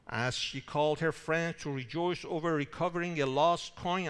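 ordinary talk

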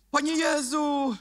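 high, drawn-out mock cry to Jesus. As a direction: parody a showy shout of prayer. Mocking people who shout. (przesadnie wzniosłe, przeciągnięte wołanie)

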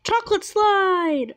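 high, drawn-out cheer; excited. Real, not acted. Player reaching the chocolate slide, excited. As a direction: stretch it out with glee.

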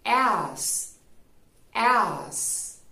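As ending in a hissed, unvoiced "s"; s sound. Shown as a demonstration. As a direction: end As with a sharp "s". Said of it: "se pronunciaría con sonido de S".

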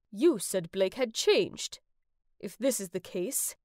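lightly voiced stern father, measured and low.

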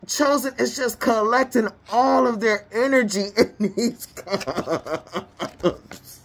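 breaking into laughter mid-sentence; laughing. Real, not acted. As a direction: talk until the laugh takes over. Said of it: "let me stop laughing".

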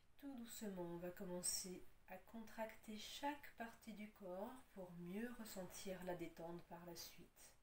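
calm, unhurried yoga nidra guidance with long pauses; guided relaxation. Acted. Yoga teacher settles students lying on their backs, guided relaxation. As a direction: ease them into rest, leaving space between instructions.

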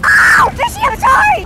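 high, loud yelled sorry; real yelled apology, high; loud mock apology. Real, not acted. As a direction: shout an apology after throwing a teammate. Sacrificed a teammate.

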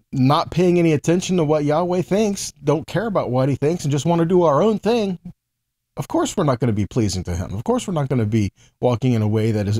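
ordinary delivery

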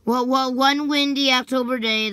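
ordinary delivery, nothing marked

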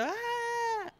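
high, far-off mock scream; retold concert scream. Retelling his scream at the [name] concert, a high thin yell. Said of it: "Y yo grito".

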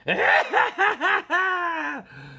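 loud, high-pitched villain cackle; Joker cackle. Acted. Showing off a villain impression, loud shrieking cackle. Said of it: "Now I really sound like the Joker".

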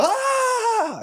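high, drawn-out scream; mock scream. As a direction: imitate people screaming as they flee. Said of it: "They go".

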